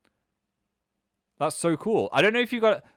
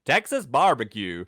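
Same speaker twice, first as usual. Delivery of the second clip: British speaker putting on Texas accent; 5 dB louder than usual. Shown as a demonstration. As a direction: try a Texan "barbecue", louder. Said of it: "I tried so hard to do the Texas".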